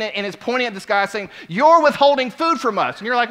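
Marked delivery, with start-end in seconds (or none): 1.5-3.0 high and loud accusing shout; indignant yelling. Retold or imitated. Lady with a full plate accuses worker, loud and high. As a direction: hurl the accusation at him.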